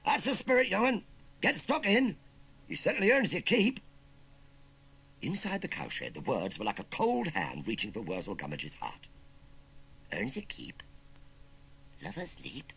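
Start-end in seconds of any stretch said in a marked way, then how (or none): 0.0-3.8 high, loud hearty encouragement; cheerful call. Acted. Farmer praising the hard worker, cheerful call. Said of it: "called out cheerfully". 10.1-12.7 understated puzzled mutter. Acted. Uncle repeating the farmer's words while shovelling, muttered. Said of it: "he muttered, shoveling even more frantically".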